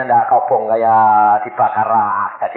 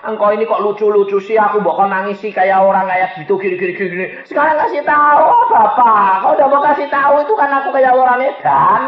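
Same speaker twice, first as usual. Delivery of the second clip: high, insistent whine to a father; acted childish pleading, building louder; whining child; 10 semitones higher, 5 dB louder than usual. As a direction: pester God like a child begging Daddy. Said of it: "seperti anak kecil ngerengek sama bapak".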